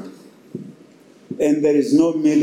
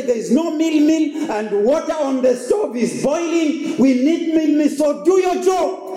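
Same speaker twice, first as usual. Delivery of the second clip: demanding wife; 10 semitones higher than usual. Retold or imitated. Wife telling husband to buy meal, insistent. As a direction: push him out the door. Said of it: "reliable husband".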